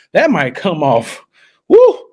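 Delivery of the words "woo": high, loud whoop of excitement; a loud, high-pitched celebratory woo; excited whoop. Real, not acted. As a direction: let out a sudden gleeful woo. Excited outburst on livestream.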